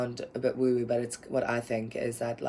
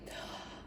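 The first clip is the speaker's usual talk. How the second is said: soft amazed gasp, imitating a kid; quick breathless gasp of delight; little hushed gasp; childlike gasp of wonder; 14 dB quieter than usual. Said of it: "I'd be like".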